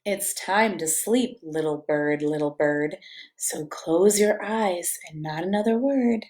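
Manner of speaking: cozy storybook reading; reading to children. A narrator's reading. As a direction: lull a toddler toward sleep. Reads picture book to child.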